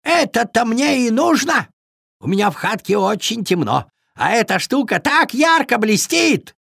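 high-pitched beaver voice; eager beaver. A narrator's reading. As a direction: claim it keenly as a little beaver. Beaver claims the shiny thing.

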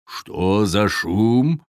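very slow, drawn-out hero voice; Viking's booming question. A narrator's reading. Viking interrupts the quarrel, asking slowly. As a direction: stretch it out, big and unhurried. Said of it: "раздался вдруг громкий голос".

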